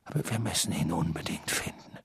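urgent whisper. A narrator's reading. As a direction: breathe it out urgently. Dumbledore hushed urgency.

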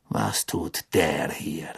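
low, slow, quiet contempt; cold anger. A narrator's reading. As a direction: hiss it out with disgust. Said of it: "Zorn und Entsetzen".

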